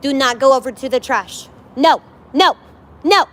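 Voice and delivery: sharp loud no; real scolding shout at the puppy; yelling at dog. Real, not acted. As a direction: stop the puppy from the trash, loud. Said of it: "I have to yell at him sometimes".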